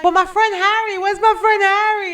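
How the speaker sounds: high, loud, whining for Harry; drunk whiny search. Retold or imitated. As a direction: cry out for your missing friend. Drunk searching for lost friend.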